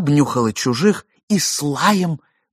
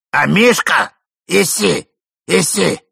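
raised, higher-pitched dog call; 6 semitones higher, 5 dB louder than usual. A narrator's reading. Old man summoning dog from under bed, calling out. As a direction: coax the dog out with loud calls. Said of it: "вызывая Амишку из-под кровати".

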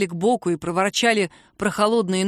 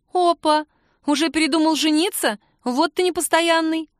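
much higher, mock-shocked teasing; mock surprise; 8 semitones higher, 3 dB louder than usual. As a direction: gasp in fake outrage.

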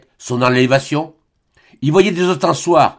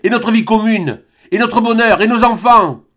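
high, loud, desperate pleading; 10 semitones higher, 4 dB louder than usual. Acted. Albine pleading with Serge about their shared life, high and loud. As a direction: reach for him with every question.

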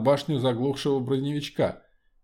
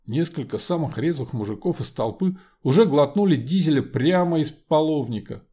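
usual speaking voice

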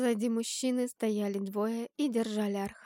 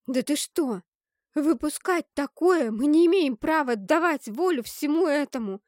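loud, raised, swooping protest; indignant refusal; 3 semitones higher, 7 dB louder than usual. A narrator's reading. Narrator refusing to release such power, loud and indignant.

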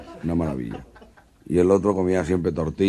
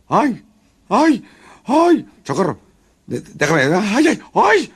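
screams of pain, very high and loud; gritos de miedo; 8 dB louder, much slower than usual. Acted. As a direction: yelp in terror, rising each time. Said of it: "Unos gritos de miedo". (gritos de dolor muy agudos)

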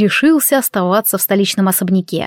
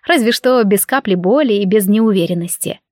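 usual speaking voice

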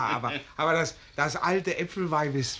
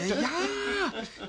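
hohe Stimme; 5 dB quieter than usual. Acted. Betrunkenes Schwärmen, hohe Stimme.

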